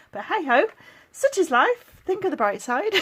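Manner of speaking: loud, high bright-side cheer; forced cheer. Real, not acted. To viewers after sad news, forced loud cheer. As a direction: brush off the sadness brightly.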